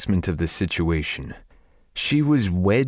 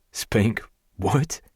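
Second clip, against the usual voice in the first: plain reading voice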